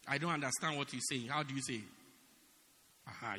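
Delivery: ordinary talk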